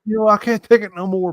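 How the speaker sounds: high-pitched cry of giving up; hammed-up desperation; mock breakdown. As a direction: act out a recruit cracking under stress. Mock breakdown of a stressed recruit.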